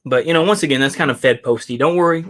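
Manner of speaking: usual speaking voice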